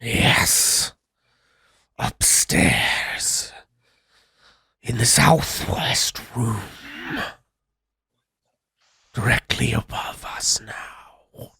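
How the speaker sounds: slow, hollow whisper from beyond the grave; ghostly whisper. Acted. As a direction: breathe it out like a trapped spirit. GM voices the spirit.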